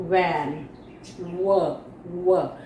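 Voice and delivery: low-pitched 'van' and 'व' with air through the lips; V sound. Shown as a demonstration. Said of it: "होठों के बीच में से हवा निकालते हुए". ('van' का व होठों से हवा निकालते हुए)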